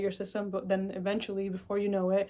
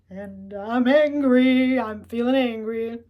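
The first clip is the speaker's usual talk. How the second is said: mild admitted anger; 2 semitones higher, 9 dB louder, much slower than usual. Acted. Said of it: "I'm just like".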